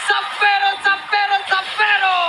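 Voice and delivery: loud excited play-by-play; esports caster. Acted. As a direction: scream the triple kill call. Hyped play-by-play of kills.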